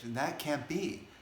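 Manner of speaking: high, indignant protest; acted angry protest. Spiritual seeker arguing with the sage, acted angry protest. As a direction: protest in offended disbelief, pitch swooping up. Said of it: "he gets really angry at the sage".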